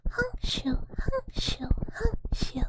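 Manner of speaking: high cartoon snore, honk in and shoo out; mock snoring. Sedated dweller snoring, imitated as a high cartoon snore. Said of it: "and then they go".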